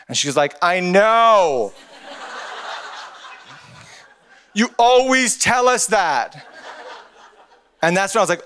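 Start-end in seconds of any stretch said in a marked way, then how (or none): 0.6-6.5 exasperated little niece. Retold or imitated. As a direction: mimic a fed-up kid, stretching it out. Acting out exasperated small niece.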